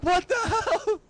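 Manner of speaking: high-pitched hammed-up alarm; shocked cops. Police find the father dead, high shocked cry. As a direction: squeal it in mock shock.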